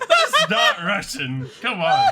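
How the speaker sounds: high, loud, cracking up laughing; laughing protest. Real, not acted. Streamer correcting friend who called French Russian, high laughing outburst. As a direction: protest loudly through helpless laughter.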